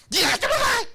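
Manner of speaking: sudden high, angry yell; irritated outburst. Acted. Preacher acting out someone woken from sleep, angry yell. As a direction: explode at whoever woke you.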